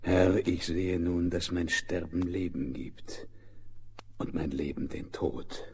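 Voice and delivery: ordinary talk, nothing marked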